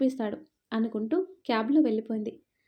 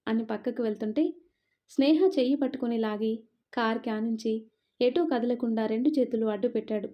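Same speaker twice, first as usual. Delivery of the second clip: ordinary delivery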